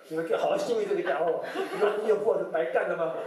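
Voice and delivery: half-laughing rant at the hospital nurses; mock angry scolding. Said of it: "害我会很生气". (半笑着的假装怒骂)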